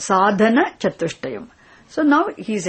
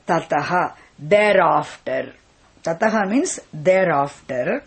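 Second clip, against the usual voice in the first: ordinary talk, nothing marked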